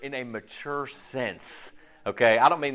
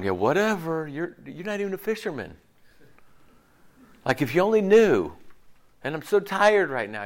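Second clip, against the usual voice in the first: dismissive sarcasm; 2 semitones higher, 6 dB louder than usual. Retold or imitated. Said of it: "he's not going".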